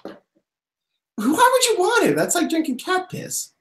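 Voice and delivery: high, drawn-out scornful question; disgusted scoff. Real, not acted. Mocking a friend's non-alcoholic beer suggestion, scornful. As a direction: scoff at the very idea.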